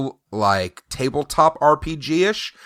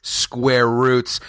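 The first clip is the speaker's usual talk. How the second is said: ordinary talk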